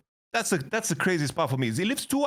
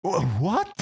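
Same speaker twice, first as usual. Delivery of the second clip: laughing disbelief; 7 semitones higher than usual. Real, not acted. To his friend, laughing in disbelief that the thief came back.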